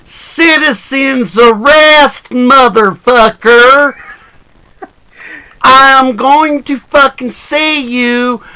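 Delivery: slow, loud drawn-out shouting; loud and clear. Acted. Announcing a citizen's arrest of the official, very slow and loud. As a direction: bellow it out slowly like a public declaration. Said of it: "I will say it very loudly and very clearly".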